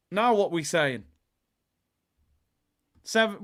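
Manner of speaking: normal talking voice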